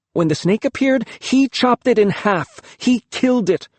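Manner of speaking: high, strained telling; high agitated. A narrator's reading. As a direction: spill it out in agitation. Boy exposes father's lie.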